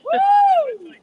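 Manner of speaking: high, loud whoop of joy; loud cheer. Real, not acted. Cheering as she spots an old friend, loud whoop. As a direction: let out a big whoop.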